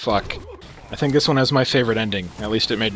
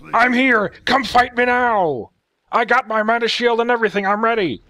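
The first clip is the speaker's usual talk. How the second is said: high mock battle cry; challenging player; 10 semitones higher than usual. Commentator voicing a player daring his opponent, hammed-up challenge.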